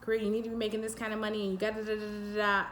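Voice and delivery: fast mock list of society's rules; rushed demands. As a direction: rattle off the demands hurriedly. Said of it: "very much the way society functions".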